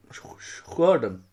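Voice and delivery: usual speaking voice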